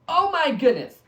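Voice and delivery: high, loud burst of amazement; amazed outburst. Real, not acted. Host reacting to the Bible verse, amazed outburst.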